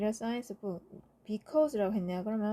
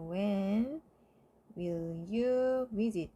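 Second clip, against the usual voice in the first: slow dictation; much slower than usual. Shown as a demonstration. Teacher dictating answer slowly.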